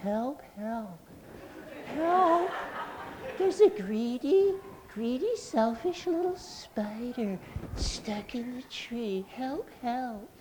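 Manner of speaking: tiny high stage whisper; soft, high-pitched mock cry for help; little whisper. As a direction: pretend to call for help, barely audible. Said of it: "in her best little whisper".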